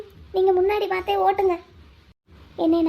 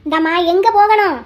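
raised voice, calling for an answer; loud demand; 6 dB louder than usual. Acted. Auto driver asks his passenger where to go, loud demand. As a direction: loudly demand to know.

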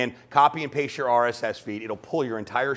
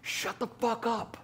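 higher, slow, softer curt command; blunt teacher; 11 semitones higher, 7 dB quieter, slower than usual. Retold or imitated. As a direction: cut him off flatly. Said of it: "And she goes".

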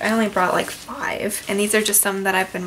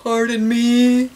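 slow, careful little bear asking for help; polite little bear; 5 dB louder, much slower than usual. Acted. Little bear politely asking the seals for help, slow and careful. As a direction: ask sweetly and slowly. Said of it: "So he asks".

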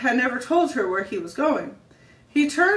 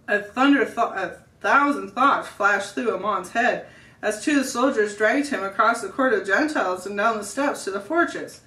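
ordinary delivery

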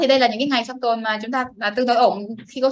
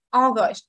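August with stress on the opening O sound; AUgust, second syllable lighter; first-syllable stress. Shown as a demonstration. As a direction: lean on the Au in August. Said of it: "nhấn âm một, âm O cho này".

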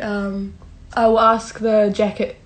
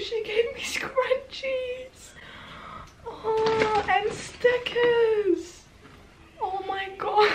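much higher, soft, slow squeaky voice; squealing awe; 11 semitones higher, 9 dB quieter, much slower than usual. Real, not acted. Unboxing the jacket and gifts, soft high squeals of joy. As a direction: squeak with overwhelmed delight.

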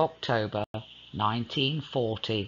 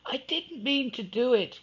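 he cried; 11 semitones higher than usual. Acted. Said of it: "he cried".

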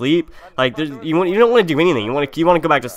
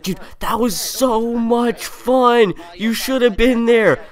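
high, slow, stretched-out excitement; hyped; 8 semitones higher, much slower than usual. Retold or imitated. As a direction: gush like a friend who just left a great party. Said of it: "he was, like, hyped".

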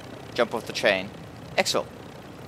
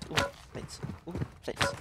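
hushed ooh; 6 semitones higher, 10 dB quieter than usual. Real, not acted. Finds loot, hushed delight.

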